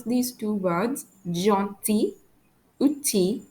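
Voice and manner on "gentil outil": "gentil" and "outil" ending in "ee"; silent L. Shown as a demonstration. French teacher demonstrating two words, no l in "gentil" or "outil". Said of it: "I don't pronounce the letter L".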